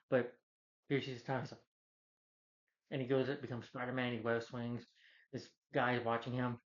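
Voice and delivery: ordinary talk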